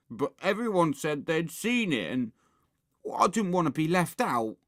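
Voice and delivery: high-pitched, pleading excuse; defensive excuse. A narrator's reading. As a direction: justify yourself plaintively. Ralph makes excuses to Henry.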